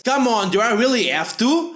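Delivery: ordinary talk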